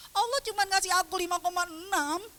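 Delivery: high, loud mock whine; child's wailing protest. Child's tearful protest, retold.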